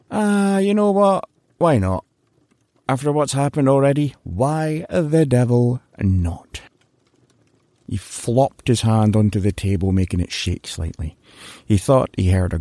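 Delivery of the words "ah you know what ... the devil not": resigned Larry. Acted.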